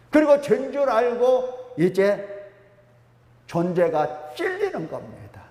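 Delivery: ordinary talk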